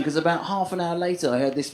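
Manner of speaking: ordinary delivery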